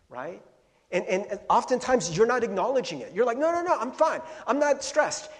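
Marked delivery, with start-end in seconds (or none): 3.4-5.3 high, loud insistent no; mock denial. Denying stress to yourself, high loud protest. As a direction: pitch it up in fake calm.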